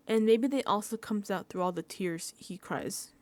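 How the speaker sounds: ordinary delivery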